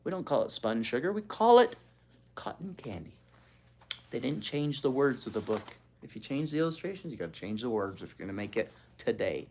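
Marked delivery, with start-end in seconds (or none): 2.4-3.2 soft unvoiced whisper; whispered aside. Real, not acted. Reader explains spun sugar to children in a whisper. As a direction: let them in on a secret.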